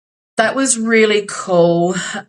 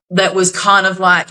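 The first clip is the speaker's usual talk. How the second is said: usual speaking voice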